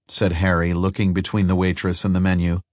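ordinary delivery

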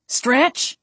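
urgent shout across the street; yell. A narrator's reading. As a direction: call out sharply to her. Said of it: "Dick yelled".

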